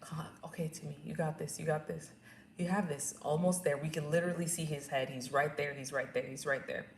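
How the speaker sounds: soft, low coaching as a birth supporter; calming encouragement. Retold or imitated. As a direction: quietly reassure someone mid-push. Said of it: "comes to calm me down".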